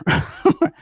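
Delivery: short unvoiced laugh; quick breathy chuckle. Real, not acted. Laughs at own muttering.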